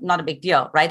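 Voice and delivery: ordinary talk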